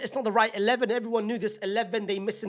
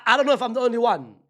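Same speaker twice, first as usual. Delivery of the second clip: ordinary talk, nothing marked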